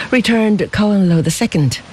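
ordinary delivery

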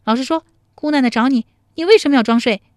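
high, loud, fast demand; bossy heroine demanding an answer; cross interrogation. Acted. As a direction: grill him as a feisty woman. Acted interrogation of the man.

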